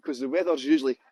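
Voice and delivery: normal talking voice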